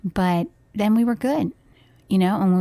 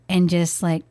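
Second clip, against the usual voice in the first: ordinary delivery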